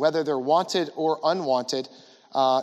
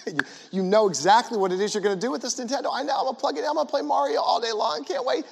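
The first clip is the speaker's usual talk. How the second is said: put-on high, fast, eager kid voice; excited kid; 9 semitones higher, faster than usual. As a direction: rattle it off like a thrilled child. Excited kid imagining Nintendo.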